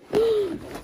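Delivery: high sharp gasp of recognition; delighted gasp. Real, not acted. Recognizing the book in the package, delighted gasp. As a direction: gasp as you realize.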